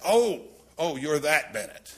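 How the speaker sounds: high, loud "oh" of recognition; suddenly placing the name, delighted. Retold or imitated.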